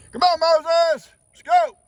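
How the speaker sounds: high, loud call across the pasture. Real, not acted. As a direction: call the bull in from far off. Calling the bull across pasture.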